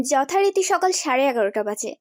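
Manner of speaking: ordinary delivery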